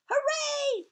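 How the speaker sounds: very high, loud cheer; shouted cheer. Acted. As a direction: cheer at the top of your voice. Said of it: "we shouted".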